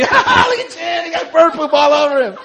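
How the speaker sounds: shouted high teasing through laughter; mock him like a crowd of kids; laughing taunt. Classmates laughing and shouting at Chin, high and loud. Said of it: "Everybody's laughing at me".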